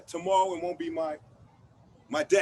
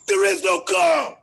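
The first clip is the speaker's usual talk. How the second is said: very loud, slow, higher outburst; explosive roar; 6 semitones higher, 10 dB louder than usual. Acted. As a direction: blow up on the final line. Climax of angry monologue.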